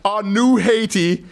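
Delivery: high, slow mock-solemn chant, cracking up; laughing through the prayer. A host reads the dictator's prayer, cracking up. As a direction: intone it like church while giggling. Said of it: "I gotta read this".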